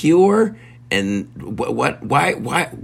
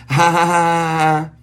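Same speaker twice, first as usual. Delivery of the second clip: slow hammy ha ha ha; hammy mock laugh; fake laugh; 8 semitones higher, 3 dB louder, slower than usual. Spelled laugh.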